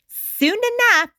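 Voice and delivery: plain reading voice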